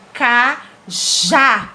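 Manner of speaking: JÁ com vogal aberta, forte; "ca, já" sílaba por sílaba, abrindo bem o A de JÁ; som aberto. Shown as a demonstration. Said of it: "abre o som". (sílabas separadas, "já" bem aberto)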